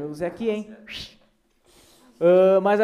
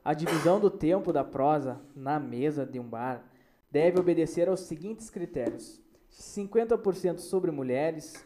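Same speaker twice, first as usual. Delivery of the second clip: solemn proclamation; 4 semitones lower, 6 dB quieter, slower than usual. Acted. Reads out the bar commandment.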